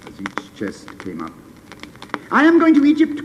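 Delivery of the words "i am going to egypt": very high, loud bird's cry. Acted. Swallow calling to the sailors, high and loud. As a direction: call out over the harbour. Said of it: "cried the swallow".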